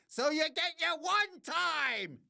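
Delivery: high-pitched, strained holler; comic high-pitched outburst; mock yell. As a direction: yell it high like a hype man. Mock yell in promo read.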